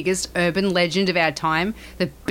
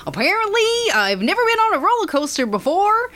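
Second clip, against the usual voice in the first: high, excited kid voice imitation; imitating an over-the-top American kid; 7 semitones higher than usual. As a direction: mimic a cute chubby kid on the news, pitched way up. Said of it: "in the most American accent possible".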